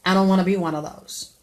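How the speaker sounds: usual speaking voice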